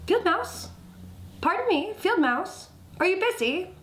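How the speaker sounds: high, eager hail; polite rock calling out. A narrator's reading. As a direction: politely catch a passerby's attention. Rock calls out politely to mouse.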